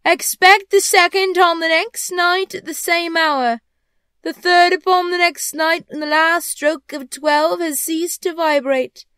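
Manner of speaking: slow, high, loud intoning; commanding ghost. Acted. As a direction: deliver parting orders like a solemn phantom. Ghost instructs Scrooge.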